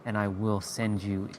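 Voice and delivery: low, hushed menacing threat. Retold or imitated. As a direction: quietly warn them you will come for them. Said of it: "I give them the look".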